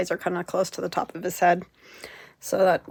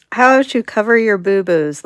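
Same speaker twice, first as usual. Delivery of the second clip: mock announcer, louder; playful announcer; 3 semitones higher, 11 dB louder than usual. Real, not acted. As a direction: proclaim it like a show title. Joking announcement of mistakes.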